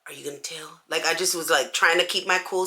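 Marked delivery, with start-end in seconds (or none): 0.1-0.8 much softer, nervous hush; scared to be told on; pleading softly with the sisters; fearful. Retold or imitated. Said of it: "looking at them in fear".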